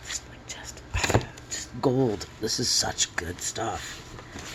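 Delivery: a sigh, then a slow, voiceless whisper; slow whispered reverence, a sigh first; awed whisper. Real, not acted. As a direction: hush it out in reverence. Moved by the books.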